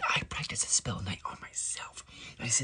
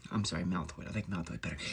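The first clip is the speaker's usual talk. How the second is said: ordinary talk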